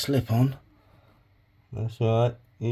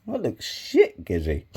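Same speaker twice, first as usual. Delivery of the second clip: mocking viewer; 6 dB louder, slower than usual. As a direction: voice the jeering viewer. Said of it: "Know what you're thinking".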